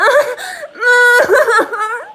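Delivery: high cracking laugh-sob, half mock; fake crying laugh. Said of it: "you want me to be like the news?".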